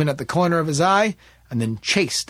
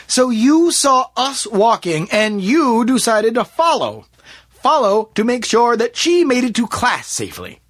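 pointed scepticism; 10 semitones higher, 7 dB louder than usual. Acted. Smith testing David's excuse, loudly sceptical. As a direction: spell his story back to him doubtfully.

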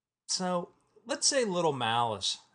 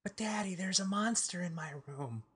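put-on higher, softer pleading child voice; scared little girl; 5 semitones higher, 4 dB quieter than usual. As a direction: plead like a frightened kid. Daughter voiced in a skit.

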